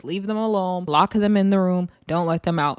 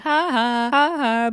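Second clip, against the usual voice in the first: mock seated laugh, raised a little; mimicked laugh; 5 semitones higher, slower than usual. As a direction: imitate her contained "ha, ha" before the outburst. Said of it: "she's sitting down like".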